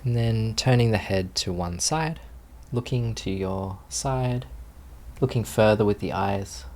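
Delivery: guided meditation. Acted. Teacher settling students into posture, calm guided meditation voice. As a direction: ease the group gently into stillness.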